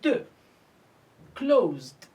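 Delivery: "d" alone, then a falling "closed"; final d sound. Shown as a demonstration. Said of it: "So we pronounce it".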